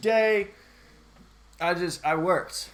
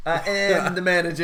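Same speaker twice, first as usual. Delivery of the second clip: loud proud announcement; triumphant declaration; 2 semitones higher, 8 dB louder than usual. Pretend. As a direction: declare it loudly like a hero. Mock triumphant manager line.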